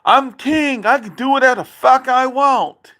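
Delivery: imitating dad crowing high and loud; mocking dad's boast. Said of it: "You said to Joe Biden".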